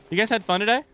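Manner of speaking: bright upbeat question to chat; cheery. Real, not acted. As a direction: perk up for the viewers.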